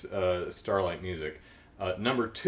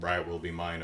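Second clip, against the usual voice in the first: low, soft chant under the breath; softly murmured incantation; murmuring; 5 semitones lower, 6 dB quieter than usual. Retold or imitated. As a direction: mutter it like a spell, lower and softer.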